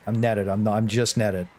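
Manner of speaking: ordinary talk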